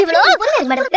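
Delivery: ordinary delivery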